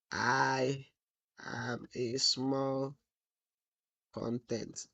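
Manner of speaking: slow and soft, word by word; typing aloud. Real, not acted. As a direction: draw the words out as you type them. Dictating to self while typing.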